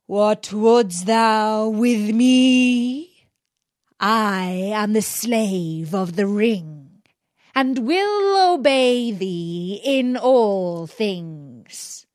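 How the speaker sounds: very slow, booming genie voice; frightful genie. Acted. Genie rising from the earth offers Aladdin service, very slow solemn voice. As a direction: intone each word like an ancient servant spirit.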